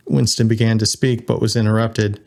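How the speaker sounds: ordinary delivery, nothing marked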